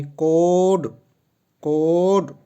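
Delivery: कोड with a drawn-out open o; longer vowel demo. Shown as a demonstration. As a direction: stretch the vowel in कोड like cord. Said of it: "ठीक है, longer है ना".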